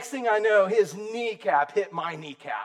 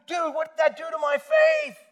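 excited shout; 7 semitones higher than usual. Real, not acted. Preacher excited about faith.

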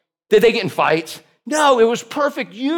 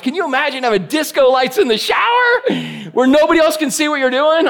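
laughing; 5 semitones higher than usual. Real, not acted. Joking about shower disco lights.

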